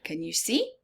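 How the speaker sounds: plain reading voice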